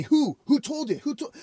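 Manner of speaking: high, softened mock demand; imitated badgering. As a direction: repeat the question like an insistent interrogator. Retelling man's repeated demand.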